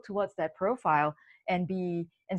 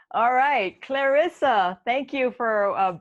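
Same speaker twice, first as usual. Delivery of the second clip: laughter still in the voice, louder and higher; laughing through words; 6 semitones higher, 8 dB louder, much slower than usual. Real, not acted. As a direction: carry the laugh into the handoff. Host laughing while introducing next.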